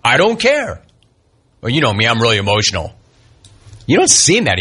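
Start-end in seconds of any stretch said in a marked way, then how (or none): 0.0-0.8 high, falling dismissive imitation; mock indifference. Contrasts player's two claimed attitudes. 1.6-2.9 low, subdued imitation; mock sheepishness. Mocks the contradicting claim.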